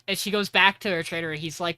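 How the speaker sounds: ordinary delivery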